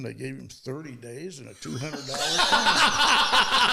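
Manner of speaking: chuckling while delivering the punchline; laughing through it. Real, not acted. Father telling his kids the punchline, laughing. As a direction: laugh through the words as you tell it.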